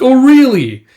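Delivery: high, loud incredulous comeback; mock surprise. One soldier answers a comrade's boast, in mock surprise. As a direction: lift it way up, loud and disbelieving. Teasing comeback in retold banter.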